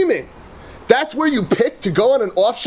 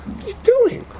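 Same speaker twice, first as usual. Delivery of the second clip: shoots up high in disbelief; outraged question; 12 semitones higher than usual. Real, not acted. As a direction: challenge them in sharp disbelief. Outraged rhetorical challenge.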